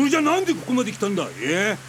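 loud, high and fast interrogation; angry demand. Acted. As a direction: fire the question at her. 女を問い詰める男. (早口で怒鳴る問い詰め)

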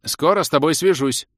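loud parting call. A narrator's reading. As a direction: shout a parting promise after someone leaving. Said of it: "крикнула баронесса".

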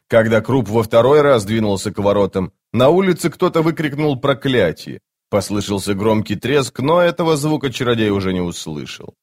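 ordinary delivery, nothing marked

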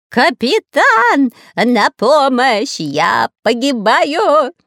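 very slow, high, loud yell; wild yelling. Acted. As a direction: howl for help in panic. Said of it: "орал он диким голосом".

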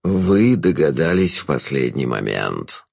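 low, slow villain's voice; low, unhurried trickster; sly Jester. A narrator's reading. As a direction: draw it out low and knowing. Jester replies to girl.

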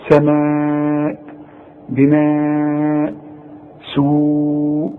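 سماء بناء سوء each stretched long and slow; very slow recited madd; drawn-out madd. Shown as a demonstration. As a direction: hold the long vowel four counts. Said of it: "لازم تمد هذه الكلمة".

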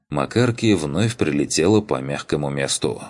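plain reading voice

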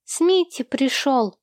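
lightly voiced small boy; little boy announcing. A narrator's reading. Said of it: "сообщил Эрни, малыш Сэм".

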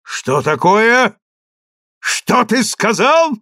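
slow, high, menacing challenge; narrated outraged cry; offended shout. A narrator's reading. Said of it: "закричал Вассос на Ровули".

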